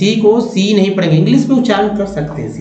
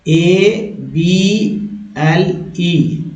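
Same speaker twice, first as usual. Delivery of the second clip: spelled very slowly; much slower than usual. Shown as a demonstration. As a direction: spell एबीएलई letter by letter, very slow. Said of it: "इसे एबल बोलते हैं".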